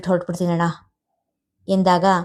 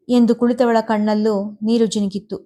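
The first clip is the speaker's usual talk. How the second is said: ordinary delivery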